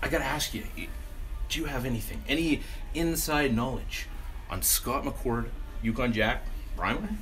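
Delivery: ordinary talk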